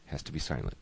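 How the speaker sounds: ordinary delivery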